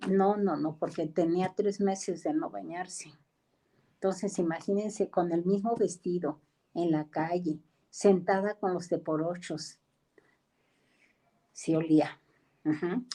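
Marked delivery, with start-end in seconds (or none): none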